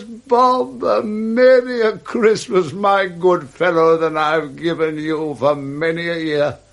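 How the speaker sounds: beam out a generous Christmas wish; jubilant Scrooge. Acted.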